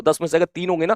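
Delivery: normal talking voice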